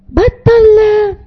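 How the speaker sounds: high, very slow stretched stop; mock scolding. Shown as a demonstration. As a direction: drag out the scolding word.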